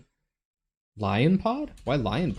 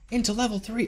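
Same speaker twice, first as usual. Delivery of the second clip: imitating notification voice; 10 semitones higher, 4 dB quieter than usual.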